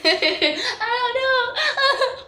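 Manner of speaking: put-on high, very slow drunken laugh-sob; slow high voice torn between laughter and tears; laughing while crying. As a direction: laugh and cry at once, drunk. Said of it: "laughing while crying".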